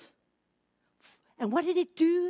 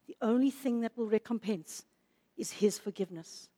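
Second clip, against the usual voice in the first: low, soft, solemn appeal; grave near-murmur about a broken heart; hushed preaching; 4 semitones lower, 6 dB quieter than usual. Real, not acted. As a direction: preach it hushed, with long pauses. Preacher drops into hushed, sorrowful appeal.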